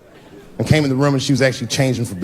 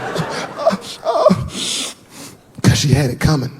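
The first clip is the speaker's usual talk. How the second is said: put-on cocky kid's tough-guy reply; gangster answer; 3 semitones higher, slower than usual. Son answers the parents, gangster attitude. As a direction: say it like a little gangster. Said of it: "his answer was so gangster".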